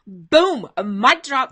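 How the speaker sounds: loud, punchy exclamation; a big celebratory boom; excited boom. Real, not acted. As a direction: slam it down like a mic drop. Celebrating a viewer's comment.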